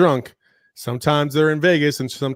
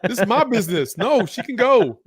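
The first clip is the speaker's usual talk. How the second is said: higher, animated refusal; playfully indignant, pitched up; mock protest; 7 semitones higher than usual. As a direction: push back like you want no part of it. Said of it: "I'm like".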